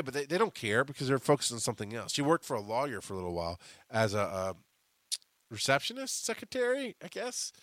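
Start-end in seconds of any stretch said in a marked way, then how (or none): none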